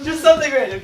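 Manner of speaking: high-pitched laugh through the words. Real, not acted. Girl still giggling about the random line, laughing.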